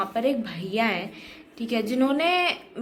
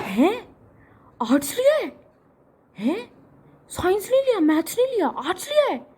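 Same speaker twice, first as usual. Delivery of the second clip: high, loud, incredulous squeal of disbelief; mock outrage that she picked arts; shocked disbelief; 9 semitones higher, 4 dB louder, faster than usual. Guest reacting to arts choice with shocked high disbelief. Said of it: "ये reaction था".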